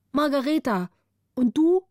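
put-on a bright, sleepy girl answering; young girl's voice. Margareta answers Gritta in a young girl's voice.

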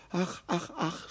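soft, pained sighs of a hurt deer; a deer's quiet sighing ach. Acted. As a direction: sigh each ach out softly. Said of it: "seufzte das Reh".

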